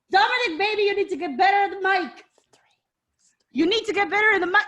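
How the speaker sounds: high, rapid exasperated coaching; exasperated mock plea. Mock plea to an absent wrestler.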